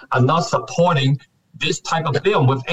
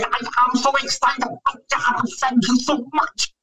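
high, squealing mock excitement; mock excited gush; 11 semitones higher than usual. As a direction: gush like a starstruck fan. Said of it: "he can't say".

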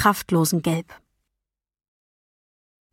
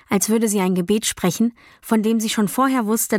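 plain reading voice

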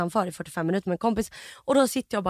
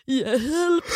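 imitated patient's wailing cry; mock tearful call; calling for help; 2 semitones higher than usual. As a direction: mimic the roommate's drawn-out plea. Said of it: "den personen bara".

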